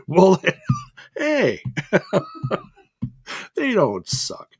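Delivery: laughing it out. Real, not acted. Laughing as she defends TNA to her partner. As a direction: laugh through a playful defence.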